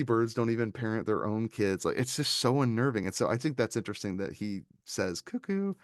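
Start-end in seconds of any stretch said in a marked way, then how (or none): 5.2-5.7 high, lilting "Cuckoo" calling out; sing-song call. Retold or imitated. Retelling the villain calling to the girl, high sing-song "Cuckoo". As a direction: coo it up high to catch her ear. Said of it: "to get their attention".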